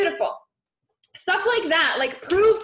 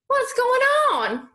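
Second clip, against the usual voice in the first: mock surprise; 10 semitones higher, slower than usual. Real, not acted. No comments appearing, host reacts.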